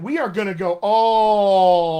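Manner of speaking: "all" stretched very long and loud; big, sustained loud "all"; drawn-out emphasis. Real, not acted. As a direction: hold "all" out to show the whole distance. Stretches word to illustrate the distance.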